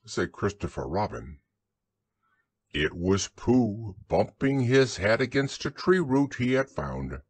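usual speaking voice

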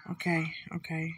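hushed breathy okay; whispering. Real, not acted. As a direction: whisper so they don't hear. Hushed while sneaking.

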